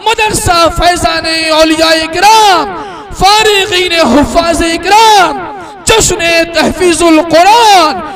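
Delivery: high, loud, drawn-out chant calls to the crowd; slogan calls. Acted. Announcer leading the crowd in slogans, high, loud and drawn out. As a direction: lead the crowd in slogans, each one stretched.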